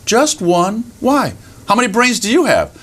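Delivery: high, curious little rabbit voice; innocent rabbit. Acted. Rabbit answers the boasting fox, innocently curious. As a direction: answer simply, then ask back high. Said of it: "And the rabbit looks up".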